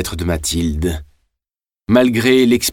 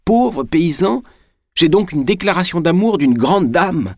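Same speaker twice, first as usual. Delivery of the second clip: high-pitched, drawn-out marvelling exclamation; elated disbelief, pitched well up; uncontainable joy; 10 semitones higher than usual. A narrator's reading. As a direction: exclaim it high, passion spilling over. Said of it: "la passion étant trop forte".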